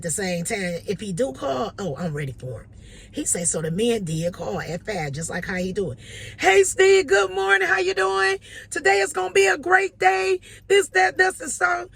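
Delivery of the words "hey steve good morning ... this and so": very high, loud, shouted good morning; booming cheerful greeting. Retold or imitated. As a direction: blast the sunshine down the phone. Caller's cheery five a.m. call.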